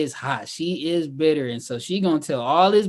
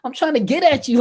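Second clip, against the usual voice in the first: mock player; 11 semitones higher, 5 dB louder than usual. Acting Marcus hitting on Jacqueline.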